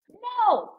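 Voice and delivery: high, drawn-out mock no; emphatic refusal. Overstimulated mom refusing her son.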